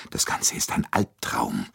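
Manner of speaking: usual speaking voice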